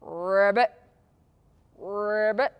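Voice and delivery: low, rolled frog croak; short trilled ribbit. Shown as a demonstration. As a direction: imitate a frog, rising at the end. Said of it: "Hear its funny sound".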